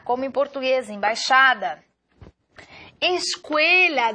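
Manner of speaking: ordinary delivery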